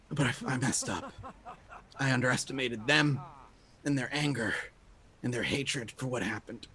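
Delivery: soft, halting confession of a wounded man; quiet phrases, pausing between each one; pained, short of breath. Acted. Wounded exorcist explains how he trapped everyone, weakly. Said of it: "pained and short of breath".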